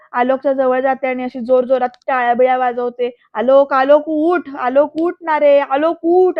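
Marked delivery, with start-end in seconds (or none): 3.3-6.4 loud, slow desperate scream of his name. Retold or imitated. Ambika screaming at Alok to get up, loud desperate shout. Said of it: "अशी किंचाळते अक्षरशः".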